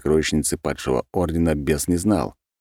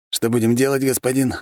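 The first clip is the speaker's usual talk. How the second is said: put-on higher, eager young servant; 8 semitones higher than usual. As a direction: ask the master like a loyal servant. Servant Henry asks master. (слуга)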